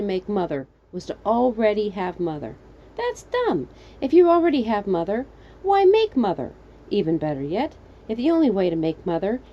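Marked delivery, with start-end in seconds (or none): 3.0-6.5 high-pitched incredulous rant; exasperated. Acted. Reasoning aloud to the birds, exasperated. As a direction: argue loudly with absurd logic.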